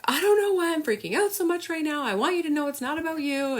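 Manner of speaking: high, teary imitation of her own sobbing voice note; mock sobbing. Retelling her sobbing voice note to a friend, high mock-teary voice. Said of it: "like, sobbing".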